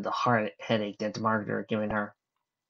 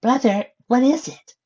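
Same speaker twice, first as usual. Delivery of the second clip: Adam's higher voice; 13 semitones higher, 6 dB louder, slower than usual. Acted.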